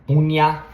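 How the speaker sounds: bunia said as in Romanian; Romanian greeting. Shown as a demonstration. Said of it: "to say hi".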